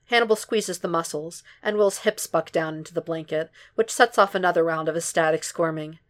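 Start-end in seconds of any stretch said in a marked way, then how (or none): none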